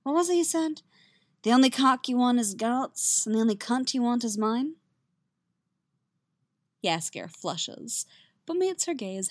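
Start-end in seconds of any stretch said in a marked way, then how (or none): 0.0-4.7 mock quoting him. Yennefer mockingly quotes Jaskier.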